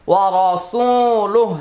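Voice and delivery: ওয়া রসুলুহ chanted slowly, ending on a stopped হ; slow recitation. Shown as a demonstration. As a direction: recite it slowly and stop on the last letter. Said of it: "ওয়াকফ করবো". (ধীরে তিলাওয়াত, শেষে ওয়াকফ)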